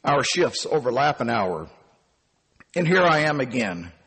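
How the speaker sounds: plain reading voice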